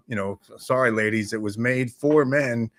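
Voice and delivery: ordinary talk, nothing marked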